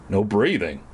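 usual speaking voice

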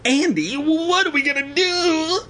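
very high, hammed-up wail; panicked stammer. A narrator's reading. Evan asking Andy for help, high panicked wail. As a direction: whine it in mock panic. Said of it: "he stammered".

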